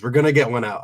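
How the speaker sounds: usual speaking voice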